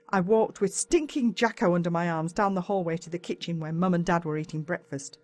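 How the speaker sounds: plain reading voice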